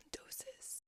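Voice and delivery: barely audible whispered echo. Real, not acted. Reminding herself of moderation, whispered.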